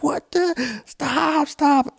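high frightened pleading; scared scouts in the cabin begging; panicked kids. Retold or imitated. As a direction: beg it to stop in fear. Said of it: "we're like".

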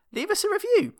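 high squeaky plea. Acted. Podcast outro plea.

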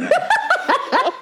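high-pitched helpless laugh; burst of laughter. Real, not acted. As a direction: crack up at a friend's joke. Reacts to co-host's crude joke.

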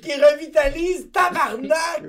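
slow, high, through laughter; laughing. Real, not acted. Repeating the friend's joke, slow and laughing. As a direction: laugh out the punchline.

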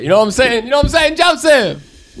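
high, pumped-up questions; excited. Real, not acted. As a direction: hype the guys up. Hyped up after beers.

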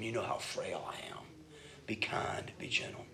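soft whispered begging prayer; David begging God for gentleness, whispered; pleading whisper. Retold or imitated. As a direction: whisper it, humble and frail. Voicing David's humble plea.

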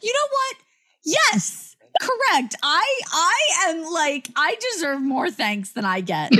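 high, self-satisfied voice; smug. Acted. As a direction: preen about your own goodness. Mock self-praising voice.

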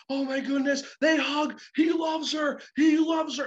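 rising excitement at the big reunion; excited retelling. Retold or imitated. Retelling crowd's emotional reaction.

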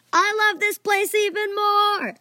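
loud, high, stretched-out cheer; overjoyed shout. A narrator's reading. Fan getting kitten bombs, shouting with joy. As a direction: yell with even greater glee.

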